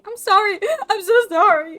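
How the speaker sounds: high, loud apology broken by laughter; laughing apology. Acted. Apologising for the messed-up order, breaking into laughter.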